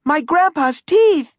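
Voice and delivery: Manny cries out as his grandpa's teeth vanish; cry out. A narrator's reading. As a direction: wail it in alarm. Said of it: "cried Manny".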